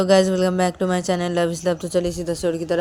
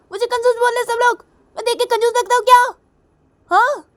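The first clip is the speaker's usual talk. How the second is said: high, loud outraged screech; angry shouting; 6 dB louder than usual. Acted. Kajal furious that everyone calls her miser, shouting. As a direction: rage in offended, piercingly high questions. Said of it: "तुम गुस्सा मत करो".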